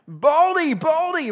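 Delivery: high, loud sing-song mocking chant; kids' taunt. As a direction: jeer at the bald prophet like a schoolyard bully. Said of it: "They're making fun of him".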